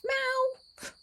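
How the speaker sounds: high, drawn-out cat meow; cat meow sign-off. Pretend. Vlogger signing off to viewers, long high cat meow. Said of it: "that should be my sign off".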